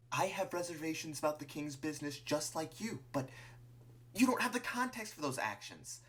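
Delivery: high, pressing character voice; earnest Martlet. Acted. Martlet reasons with Clover about the king, earnest and insistent. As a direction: argue earnestly, trying to get through.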